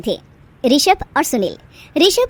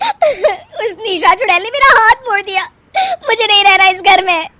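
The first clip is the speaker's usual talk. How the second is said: louder, higher, sobbing; a wife's loud, higher, tearful whine to her husband; tearful complaint; 9 semitones higher, 5 dB louder than usual. Acted. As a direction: whine through tears to your husband.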